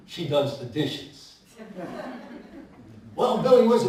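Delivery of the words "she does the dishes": low, slow, with a chuckle; Bob the baker. Acted. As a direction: drop it slow as the old baker.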